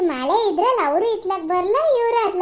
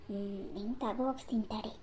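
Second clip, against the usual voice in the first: a much softer, faster mutter to herself; scheming mutter; 7 semitones lower, 16 dB quieter than usual. Acted. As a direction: plot under your breath, quickly.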